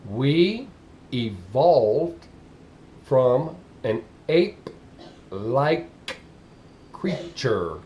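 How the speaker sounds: very slow, word by word with pauses; slow and clear. Shown as a demonstration. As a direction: spell it out as if for slow listeners. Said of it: "I'm gonna say it slow and as clear as I can".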